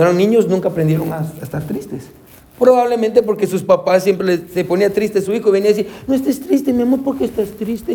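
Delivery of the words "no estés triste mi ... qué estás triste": put-on higher, cooing parent talking to a child; a hammed-up coddling mother; mock doting parent. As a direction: imitate a parent hushing a child's sadness. Parent voiced comforting sad child.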